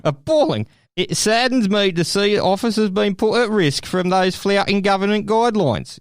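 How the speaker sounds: mock English accent, earnestly overdone; bad English accent. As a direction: do a terrible English official. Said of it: "That was the worst English accent ever".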